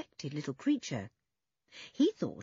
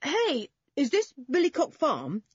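high, raised calling voice; 10 semitones higher, 6 dB louder than usual. A narrator's reading. Julian calls after the small boy, calling out. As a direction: call across to someone. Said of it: "called Julian".

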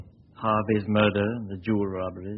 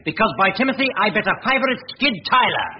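high, loud, eager exclamation; bursting with the hunch; triumphant excitement; 11 semitones higher, 9 dB louder than usual. Acted. Detective declares his hunch to the inspector, excited and loud.

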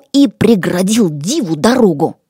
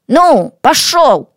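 slow, higher-pitched command to go; urging a mount; 6 semitones higher, 4 dB louder, slower than usual. Acted. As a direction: drive the mount on with a drawn-out call. Urging his human mount onward.

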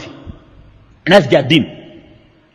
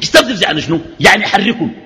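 ordinary delivery

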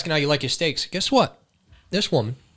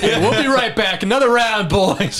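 hyped-up party toast, higher; rowdy party animal; 6 semitones higher than usual. Pretend. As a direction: cheer on the boys like a bar round. Toasting with Mountain Dew.

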